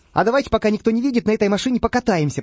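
a sly crook urging his pals; sly quick whisper-plot. Acted. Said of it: "воровато озираясь". (хитро и быстро, тоном заговорщика)

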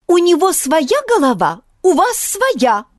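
a frightened bird scolding; nervous chirp. A narrator's reading. Sparrow scolds Grishka, nervous high chirp. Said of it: "нервно чирикнул Аполлон Мухолов".

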